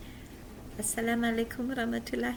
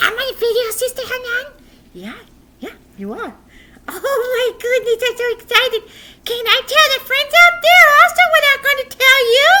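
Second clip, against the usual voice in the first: put-on high, loud puppet boy; bubbly little puppet voice; excited puppet; 16 dB louder, faster than usual. Puppet boy asks his teacher, high and eager.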